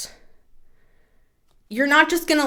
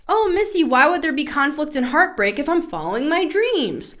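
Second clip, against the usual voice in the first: higher, whiny questioning viewer; viewer's mock-innocent question in a higher voice; doubting viewer; 7 semitones higher than usual. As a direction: play a puzzled viewer pushing back. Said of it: "Well, that's why I've titled".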